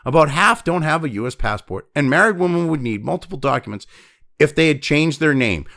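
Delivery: usual speaking voice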